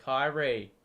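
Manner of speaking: ordinary talk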